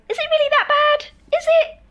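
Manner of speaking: mock disbelief squeaked up high; doubting. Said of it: "a part of you going".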